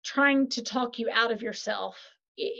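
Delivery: usual speaking voice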